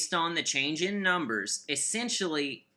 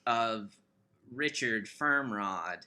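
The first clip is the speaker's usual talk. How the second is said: lightly voiced romance author; sultry narrator; 6 semitones lower, 4 dB quieter than usual. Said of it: "This is very sultry".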